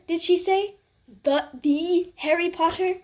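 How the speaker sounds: gossiping students; whispering crowd. Acted. Whispers in the hall.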